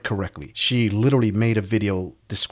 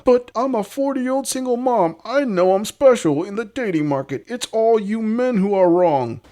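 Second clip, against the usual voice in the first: mocking woman impression; 11 semitones higher, 4 dB louder than usual. Host parodying single mom blaming men, falsetto. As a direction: whine it high like an entitled dater.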